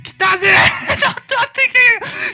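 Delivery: screaming at the top of his lungs, high and loud; yelling with joy at the rare catch; ecstatic shouting. Real, not acted. Streamer finally gets Doraemon, ecstatic shouting to viewers. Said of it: "めちゃめちゃ大声でしゃ-、叫んじゃって".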